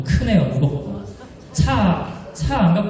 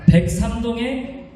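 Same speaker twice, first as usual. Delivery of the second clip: booming prize announcer; 5 semitones higher, slower than usual. Real, not acted. MC calls winning apartment number.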